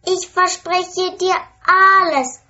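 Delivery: high princess promising quickly; just say yes to anything; eager promise. A narrator's reading. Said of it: "sagt die Königstochter".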